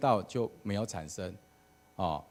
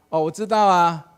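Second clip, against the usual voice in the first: much higher, loud sing-song answer; congregation calling back; 7 semitones higher, 10 dB louder than usual. Retold or imitated. The crowd shouts back to the pastor that they know, loud and high. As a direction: call it out cheerfully as the crowd.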